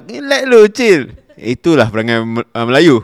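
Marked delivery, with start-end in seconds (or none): 0.0-1.0 mock laid-back. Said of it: "bila kau cakap".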